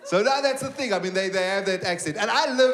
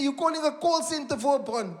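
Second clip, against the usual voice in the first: high-pitched incredulous scoff; mocking disbelief; 7 semitones higher than usual. Call centre agent making fun of bond caller, incredulous. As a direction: scoff at him in a raised voice. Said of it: "at the call center making fun of me".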